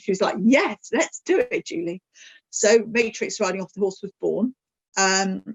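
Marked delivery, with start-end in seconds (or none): 0.4-2.0 enthusiastic. Retold or imitated. Bliss agreeing to the plan, enthusiastic and high. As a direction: sell the excitement of a friend. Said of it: "Bliss is really enthusiastic".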